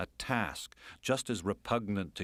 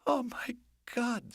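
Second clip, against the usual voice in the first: high, slow oh my God; woman clinging to him as the helicopter hovers, startled; slower than usual. Acted.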